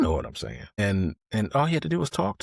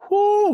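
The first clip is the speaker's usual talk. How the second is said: whoop; 9 dB louder than usual. Real, not acted. Recalling the chaos.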